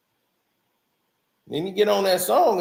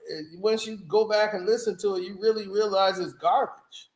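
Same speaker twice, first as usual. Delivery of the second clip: ordinary delivery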